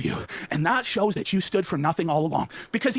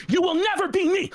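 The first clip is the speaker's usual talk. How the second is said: high-pitched shouted taunt; 9 semitones higher than usual. Acted.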